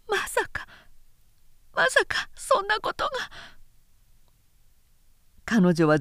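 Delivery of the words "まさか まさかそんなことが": a woman recognising a voice she dreads; stunned disbelief. Acted. Nao realising who her secret lover is, in high, hushed disbelief. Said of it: "非常な驚愕に打たれた".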